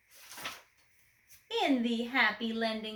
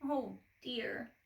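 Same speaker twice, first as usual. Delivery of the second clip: ordinary delivery, nothing marked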